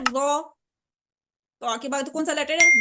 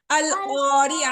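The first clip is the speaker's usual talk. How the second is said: slow model; 4 dB louder, much slower than usual. Shown as a demonstration. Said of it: "teacher models again".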